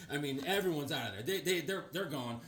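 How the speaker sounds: ordinary talk, nothing marked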